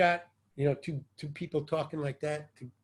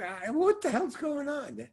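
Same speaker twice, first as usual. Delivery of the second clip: high, slow, wide-eyed confusion; slow baffled wonder; bewildered innocence; 12 semitones higher than usual. Retold or imitated. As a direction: play the lost newcomer. Showing his innocent, open self.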